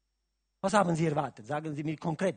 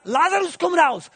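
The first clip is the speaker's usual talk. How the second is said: quoted call; 13 semitones higher, 14 dB louder than usual. Retold or imitated. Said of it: "Er hat gesagt".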